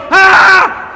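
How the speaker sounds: sudden loud high scream; startled child scream. Retold or imitated. Daughter jolted by the sheep, loud scream. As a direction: yelp as the sheep hits. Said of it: "She goes".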